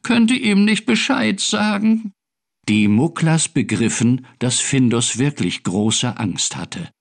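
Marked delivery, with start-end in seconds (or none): 0.0-2.1 high, pleading little cat; high kitten whine; sobbing. A narrator's reading. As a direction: plead with a touch of tears. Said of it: "schluchzte er".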